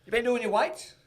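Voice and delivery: high, sing-song mock question; sugary high teasing coach voice. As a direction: send up the coach sweetly quizzing him. [name] mock-quizzing player.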